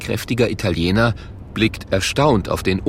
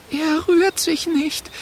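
trembling voice; slower than usual. A narrator's reading. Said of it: "mit zitternder Stimme".

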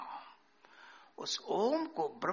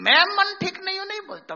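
excited emphasis; 11 semitones higher, 10 dB louder, faster than usual. Real, not acted.

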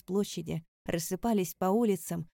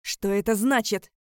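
loud growled demand; angry roar; 3 semitones higher, 9 dB louder than usual. A narrator's reading. Father roaring down from the balcony, angry roar. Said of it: "прорычал с балкона отец".

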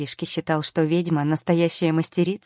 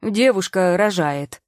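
slow, louder alarmed yell; 3 semitones higher, 6 dB louder, slower than usual. A narrator's reading. Shop assistant yelling to the gawkers. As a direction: call it out to everyone. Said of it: "крикнул им напоследок".

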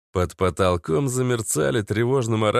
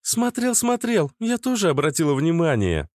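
put-on raised, playful girl's voice; teasing young woman, lightly mocking; high teasing female voice; 8 semitones higher than usual. A narrator's reading. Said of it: "включилась в игру Наташа".

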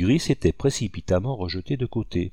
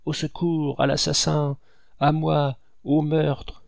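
high, panicked cries; yelling for help; 6 semitones higher, slower than usual. A narrator's reading. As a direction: cry murder in a raised pitch. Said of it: "hurla l'agent en faisant des enjambées énormes". (appels au secours affolés, aigus)

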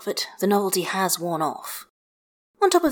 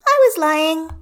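put-on high, loud proud little girl; child declaring; 11 semitones higher, 8 dB louder than usual. A narrator's reading. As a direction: announce it boldly like a cheeky kid. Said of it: "Hestia declares".

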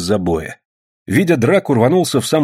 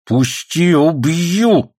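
raised-voice threat to kill; angry threat; 7 semitones higher, 3 dB louder, much slower than usual. A narrator's reading. Man swinging a shovel at his attacker, angry threat. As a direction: threaten him, voice climbing high.

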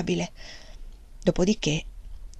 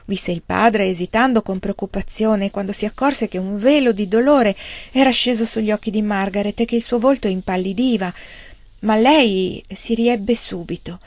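ordinary delivery

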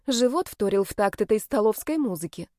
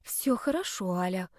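soft, hushed reassurance; calm yourself down under your breath; gentle, quiet self-comforting; self-soothing; 6 dB quieter than usual. A narrator's reading. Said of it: "успокаивала себя".

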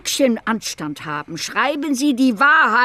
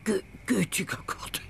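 shocked whisper; 10 dB quieter than usual. Acted. Shocked old woman recognizes him.

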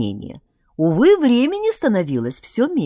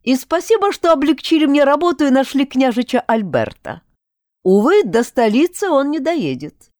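higher, smooth mock courtesy; polite villain; 6 semitones higher, 3 dB louder than usual. A narrator's reading. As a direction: politely gloat over them. Necromancer's mocking speech. (вежливый злодей, насмешливо)

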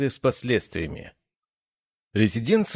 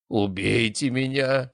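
groaning plea; 5 semitones higher, slower than usual. A narrator's reading. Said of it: "простонал он".

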